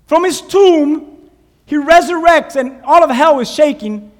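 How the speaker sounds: high, loud preaching climax; rising fervor. Real, not acted. Preacher thundering about Jesus rising from the tomb, fervent. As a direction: proclaim it like a triumph.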